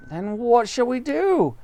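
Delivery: monkey fearing for his family; worried cry. Acted. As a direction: ask it anxiously. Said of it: "cried Mugglewump".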